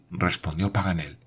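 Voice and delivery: plain reading voice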